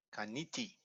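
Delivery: kaniti with the accent on the middle "ni"; stress on marked syllable. Shown as a demonstration. Teacher introducing an example word, stress on marked syllable. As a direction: softly say kaniti, leaning on "ni". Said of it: "the accent".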